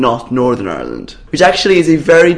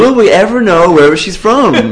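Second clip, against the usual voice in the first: asked through laughter, pitched up; laughing; 6 semitones higher, 4 dB louder than usual. Real, not acted. Host teasing her co-host about Dublin, laughing. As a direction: tease him while laughing. Teasing joke during podcast chat.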